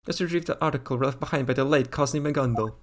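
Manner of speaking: lightly French Spy voice; French accent. Acted. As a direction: suave French villain accent. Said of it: "give him the T F two spy voice".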